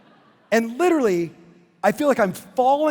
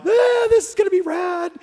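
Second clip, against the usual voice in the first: much higher, excited shout; blissed-out excitement; 10 semitones higher than usual. Retold or imitated. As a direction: whoop it out like a happy surfer. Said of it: "my buddy Claude is like".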